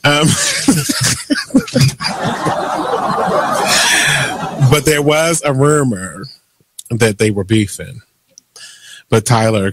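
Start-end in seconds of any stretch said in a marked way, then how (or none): 0.3-4.7 breathless high wheezing laugh; drawn-out airy fit of laughter; long laugh. Real, not acted. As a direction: crack up helplessly, mostly without voice. Laughing over made-up rumor joke.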